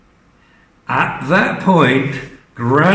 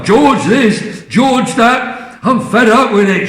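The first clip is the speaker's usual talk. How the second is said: high, louder sneer; resentful grumble; 8 semitones higher, 4 dB louder than usual. Acted. Grandma grumbling about George, loud resentful. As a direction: spit out the resentment. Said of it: "she said".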